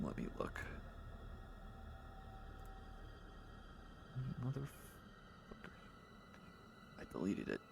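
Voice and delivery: very quiet, half-whispered mumble; muttering to self. Real, not acted. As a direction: mutter under your breath while searching. Muttering to self while searching notes.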